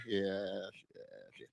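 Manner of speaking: normal talking voice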